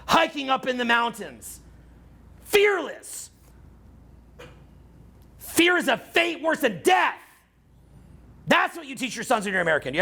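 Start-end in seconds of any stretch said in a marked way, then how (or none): 2.4-7.2 solemn motto. Acted. Motto taught to his sons.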